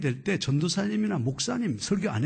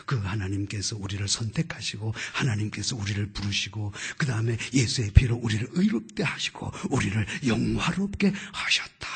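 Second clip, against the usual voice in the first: lower, hushed reading of a verse; scripture reading; 8 semitones lower than usual. Acted. Boy reading the underlined verse, reverent.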